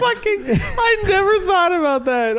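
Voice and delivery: high, helpless laughter through the words; laughing hard. Real, not acted. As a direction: crack up while talking. Cracking up at friend's observation.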